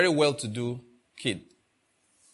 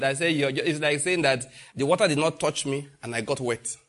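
ordinary talk

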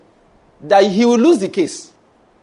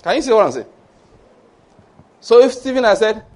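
ordinary talk

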